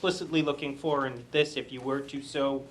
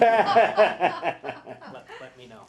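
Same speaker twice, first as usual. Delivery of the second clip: laughing; 9 semitones higher, 10 dB louder, much slower than usual. Real, not acted. Laughing after admitting he practiced.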